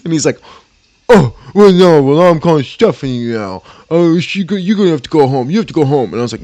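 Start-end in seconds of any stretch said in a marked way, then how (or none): 1.1-6.1 loud, higher mock retort; imitated yelling. Flustered coworker threatens to send him home, mock shouting. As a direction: throw it back as a loud threat.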